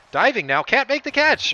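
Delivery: loud, high cry at the missed catch; excited call. Real, not acted. Commentator watching his fielder miss a diving catch, excited. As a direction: shout as the dive falls short.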